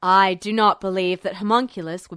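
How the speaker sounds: low, calm rumbling voice; unsettlingly composed authority; calm rumble. Acted. General speaking calmly to Mathry, low rumble. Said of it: "strangely calm voice that rumbled".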